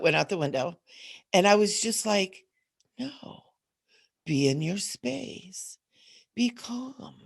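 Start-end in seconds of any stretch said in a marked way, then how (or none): 3.0-7.1 calming inner voice. Retold or imitated. Inner voice calming her anxiety.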